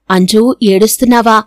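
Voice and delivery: ordinary delivery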